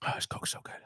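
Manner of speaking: real hushed whisper; whispered aside. Real, not acted. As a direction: whisper it to yourself, off the topic. Aside while sipping a drink.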